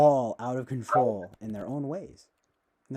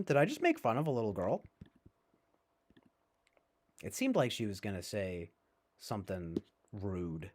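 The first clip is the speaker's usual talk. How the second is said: ordinary talk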